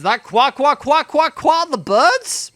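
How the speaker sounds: high, loud silly quacking; mocking quack voice. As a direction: poke fun at the bird sounds. Mock bird sounds in the game.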